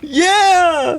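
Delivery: high cheering yeah; high excited cheer. Acted. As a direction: cheer it on with the host. Cheering the water balloon fight.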